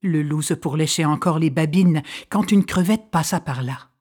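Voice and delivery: ordinary delivery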